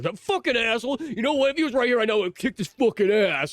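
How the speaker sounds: high-pitched ranting bravado; delayed indignation. Retold or imitated. Delayed anger at the attacker.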